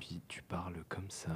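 soft, slow whisper, barely any voice; ASMR whisper. Shown as a demonstration. Demonstrating to his co-host how ASMR voices whisper, slowly. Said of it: "Oui, tu chuchotes".

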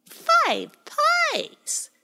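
very high, incredulous bird squawk; annoyed blackbird. Acted. The blackbird scoffs at Pie Jam's pies, very high annoyed. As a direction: scoff at the greed. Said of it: "with a rather annoyed tone".

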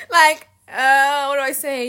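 high, loud laughing through the words; loud laughter. Real, not acted. Cracking up at her own jump jacks joke, laughing loudly.

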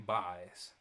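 "buys" with an "eye" vowel, pitched low; misheard "buys". Shown as a demonstration. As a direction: say "buys" like "byes", dropped low. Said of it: "thought you were saying".

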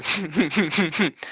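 snorting laugh through the nose; nose laugh demo. Shown as a demonstration. Showing friends his nose laugh, a snort. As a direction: show how you laugh with your mouth shut. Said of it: "So most of my laughs go".

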